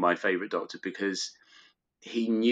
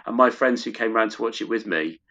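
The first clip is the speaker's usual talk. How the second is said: ordinary talk, nothing marked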